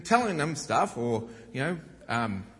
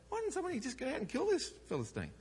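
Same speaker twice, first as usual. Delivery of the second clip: high and fast, blurting it out; an innocent boy who doesn't see the problem; eager naive teen; 13 semitones higher, 6 dB quieter, faster than usual. Retold or imitated. Teen David asking the soldiers why nobody fights.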